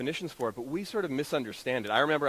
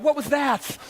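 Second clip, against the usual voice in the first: shocked at himself; 13 semitones higher, 6 dB louder, slower than usual. Retold or imitated. Catching his own lie.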